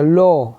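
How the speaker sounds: लो with a long rounded O vowel; open O sound. Shown as a demonstration. Teacher showing students how AW sounds, long O in लो. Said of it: "आवाज़ ओ की होती है".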